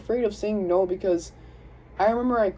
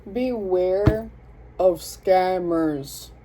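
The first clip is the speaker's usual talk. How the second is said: drawn-out, very slow warning; ominous warning; much slower than usual. Real, not acted. Reader warning the collective about scammers, slow ominous drawl.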